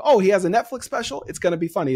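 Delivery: a jump up in pitch, mock-gushing fan voice; mock eager fan. To a cohost, mimicking fans hyped for a Netflix comic, high eager gush. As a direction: gush like a fan already sold on him.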